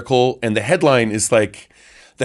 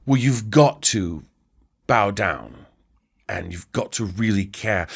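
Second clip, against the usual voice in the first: slow, softer British journalist; mock British accent; 4 semitones lower, 4 dB quieter than usual. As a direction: lightly put on a British accent, spacing out the phrases. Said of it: "That's not the Scottish accent".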